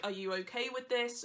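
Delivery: ordinary talk, nothing marked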